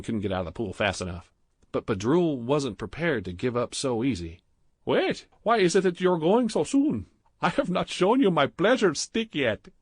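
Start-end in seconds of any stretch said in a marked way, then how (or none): 4.8-9.7 put-on higher, louder, heavy Indian accent; thick Indian accent. A narrator's reading. Clerk Badrul flirting with Stephen in the jacuzzi, thick Indian accent.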